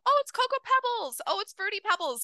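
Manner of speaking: put-on a small child proudly guessing; little kid voice. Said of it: "little kids ... were like".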